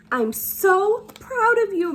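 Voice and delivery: high, slow, beaming; mother hugging her wet daughter, proud and high. Acted. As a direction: pour out the pride slowly. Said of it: "Mammy said".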